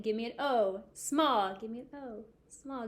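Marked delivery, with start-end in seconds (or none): 1.6-2.9 quiet echo. Shown as a demonstration. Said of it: "you repeat".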